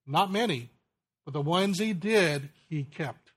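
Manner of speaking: ordinary talk, nothing marked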